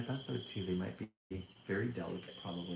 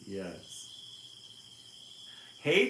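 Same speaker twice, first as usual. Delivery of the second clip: plain reading voice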